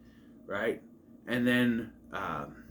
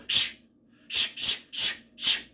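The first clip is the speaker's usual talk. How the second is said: forceful repeated "shh"; sharp mock shushes, louder than whispers; loud shushing; 4 dB louder, slower than usual. Shushing whispering pupils at school, loud shushes. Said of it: "That's louder than what we were doing".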